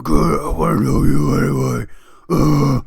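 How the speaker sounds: very loud growled comeback; mock shouted retort. Worker yelling back at the rocker, loud mock bellow.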